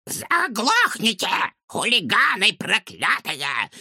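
high, slow furious granny yell; angry old woman. A narrator's reading. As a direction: scream down at the hooligans. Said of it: "злобно крикнула соседка".

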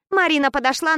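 plain reading voice

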